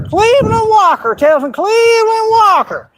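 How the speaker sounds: very high, wailed name; mocking exclamation.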